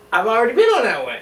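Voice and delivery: quick, loud, cocky lie to save face; fake bravado. Kid bluffing to his friends at camp, loud and quick. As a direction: bluff like you've done it all before. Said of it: "capping my ass off, obviously".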